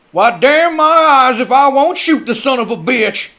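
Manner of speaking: loud, high furious threat; angry threat. Acted. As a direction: threaten to shoot him. Armed man rises, threatens.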